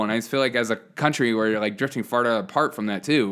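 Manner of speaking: ordinary talk, nothing marked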